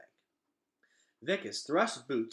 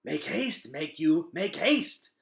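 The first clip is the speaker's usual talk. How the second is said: loud, higher insect urging; urging roaches; 6 semitones higher, 10 dB louder, slower than usual. A narrator's reading. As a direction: hurry him along, pressing. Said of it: "urged Temp and Tick".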